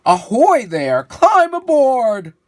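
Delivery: loud pirate holler, climb aboard rising high; hollered ahoy. Acted. Pirate calling Saul aboard from the ship, a loud holler. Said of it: "a voice hollered".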